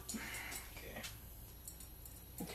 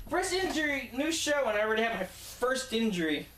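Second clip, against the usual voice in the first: mock announcer; 8 semitones higher than usual. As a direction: hype it up like breaking news. Joking about first injury.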